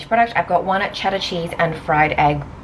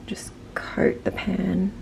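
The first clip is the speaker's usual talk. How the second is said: whispering; 7 dB quieter, much slower than usual. Real, not acted. Quiet aside while cooking.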